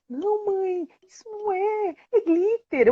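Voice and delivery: put-on high and soft little girl; doubting child. Daughter softly telling mother it is only glitter. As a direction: quietly correct mum, unconvinced.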